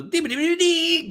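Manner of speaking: high, loud scat of nonsense syllables; hammed-up rock-star scatting.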